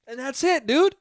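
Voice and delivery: usual speaking voice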